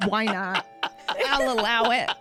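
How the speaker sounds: ordinary talk, nothing marked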